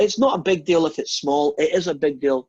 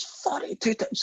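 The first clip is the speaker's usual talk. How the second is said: acted shock, voice leaping up; astonished; 13 semitones higher, 6 dB quieter than usual. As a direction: repeat it back in disbelief. Saint Peter astonished at the confession.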